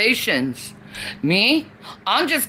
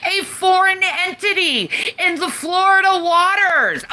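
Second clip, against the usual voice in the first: outraged rant; 7 semitones higher than usual. Real, not acted. Outraged rant about crocodiles.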